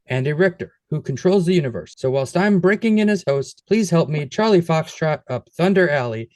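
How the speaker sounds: usual speaking voice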